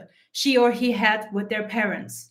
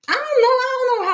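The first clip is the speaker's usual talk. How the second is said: sudden high, louder I don't know; exasperated cry; 11 semitones higher, 3 dB louder, faster than usual. Real, not acted. Speaker venting about not knowing how to be herself, exasperated. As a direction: throw up your hands in frustration.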